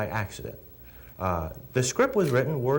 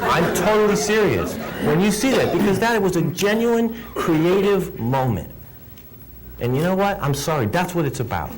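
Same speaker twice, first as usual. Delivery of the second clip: real excitement; 7 semitones higher, 6 dB louder than usual. Real, not acted. Gushing about a creative moment.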